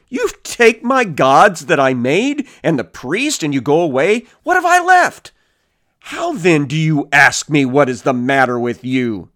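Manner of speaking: rising to an outraged high cry on the question; indignant protest. Acted. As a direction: read it as a robbed man's wounded outrage. Micah protests as scripture is read.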